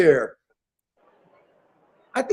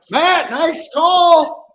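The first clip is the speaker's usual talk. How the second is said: excited shout; 7 semitones higher, 5 dB louder, much slower than usual. Real, not acted. Congratulating a chat member.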